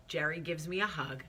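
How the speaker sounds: ordinary delivery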